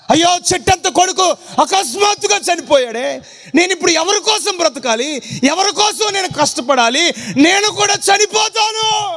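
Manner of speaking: high wailing lament of a bereaved mother; mother's lament. Acted. Bereaved mother lamenting her dead son, high and grieving. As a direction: wail it like a mother who lost her son.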